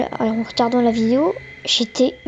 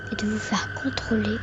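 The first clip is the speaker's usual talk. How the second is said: eerie breathy whisper; 8 dB quieter than usual. Acted. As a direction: whisper it like a secret. Creepy intro, eerie hushed aside.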